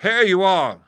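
high, loud hail; delighted cry. A narrator's reading. Squire greets the arriving boy outside the inn, crying out. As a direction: call it out high and hearty. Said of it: "he cried".

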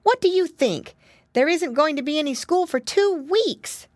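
put-on high-pitched, eager child's voice; excited little piggy boy. A narrator's reading. Piggy boy tells mama school is off, excited. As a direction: announce big news like an eager little boy.

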